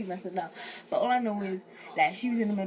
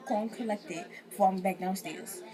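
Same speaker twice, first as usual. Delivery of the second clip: ordinary talk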